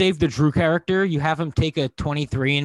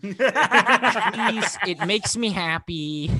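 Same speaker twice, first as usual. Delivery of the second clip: high pleading put-on voice, slowing down; cowering plea; 5 semitones higher, much slower than usual. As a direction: beg meekly like a scolded husband. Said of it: "Mike begging his wife".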